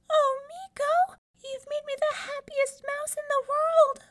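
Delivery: a tiny mouse bursting with joy; overjoyed squeaky mouse. Acted. Little mouse thanks Meeko for choosing her, squealing with joy.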